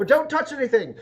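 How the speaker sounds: high-pitched character voice, edging into a laugh; character's warning. Acted. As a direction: pitch it way up as a fussy host's warning. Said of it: "and he goes".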